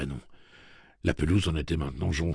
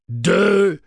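loud, high single number; raised machine call; robot countdown; 14 semitones higher, 11 dB louder than usual. A narrator's reading. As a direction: cut in with the mechanical count.

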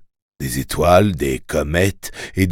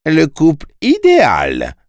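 high, swooping exclamation; exclaimed with a big pitch leap; crying out; 9 semitones higher, 4 dB louder than usual. A narrator's reading. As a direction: proclaim it for all to hear. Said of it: "s'écria-t-il".

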